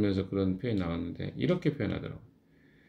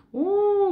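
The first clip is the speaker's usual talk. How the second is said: long, high-pitched imitated wolf howl; imitation of a wolf's howl held on one high note; 3 dB louder than usual. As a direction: howl like a distant wolf, "ooo". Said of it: "뭐 늑대".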